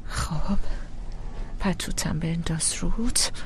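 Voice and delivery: put-on low, gentle coaxing to a frightened child; calm him down tenderly, pitched low; soothing mother.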